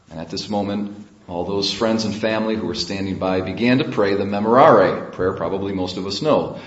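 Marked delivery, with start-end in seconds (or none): none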